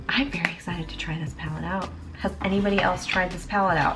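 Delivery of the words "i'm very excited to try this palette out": excited whisper. Real, not acted. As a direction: whisper it with glee.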